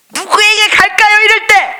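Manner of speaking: high, loud repeated question; same question, now thrown out loud. Shown as a demonstration. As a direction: call it out to the whole church. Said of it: "이럴 때 누구에게 갈까요".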